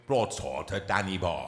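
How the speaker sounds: deep, slow impersonator's Welsh voice; Richard Burton. Acted. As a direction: drop the voice low and draw the line out. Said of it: "I tried to copy him".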